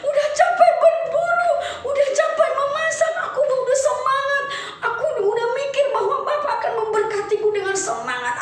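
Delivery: a son wailing at his father over the blessing; wailing Esau. Acted. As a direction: cry out the words to your father. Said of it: "menangis meraung-raung".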